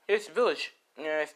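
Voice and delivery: ordinary delivery, nothing marked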